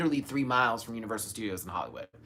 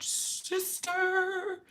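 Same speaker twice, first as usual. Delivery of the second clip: swooning; 6 dB quieter, much slower than usual. Real, not acted. Drawn-out high swoon.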